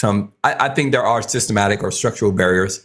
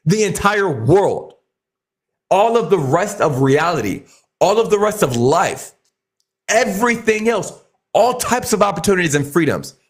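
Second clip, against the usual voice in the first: raised, higher-pitched, emphatic speechmaking; impassioned near-yelling; 6 semitones higher than usual. Real, not acted. Speaker getting something off their chest to the audience, impassioned. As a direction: get worked up, driving each phrase home. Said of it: "I didn't mean to yell".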